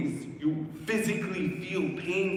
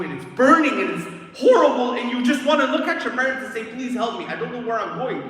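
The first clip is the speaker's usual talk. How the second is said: raised, high voice of agonized pleading; desperate pleading; 5 semitones higher, 7 dB louder than usual. Acted. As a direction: beg for help, urgent and high. Horror and plea to parents.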